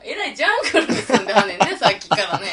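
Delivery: fast, giggly teasing; real laughter through the words; laughing while talking. Real, not acted. Laughs at listener's joke.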